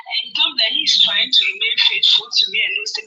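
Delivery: ordinary talk, nothing marked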